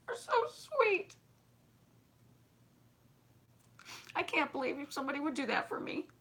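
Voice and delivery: thanking her while fighting back tears; tearful, squeaky high thanks; choked up. Real, not acted. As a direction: voice breaking high with real tears close. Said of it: "I'm gonna cry".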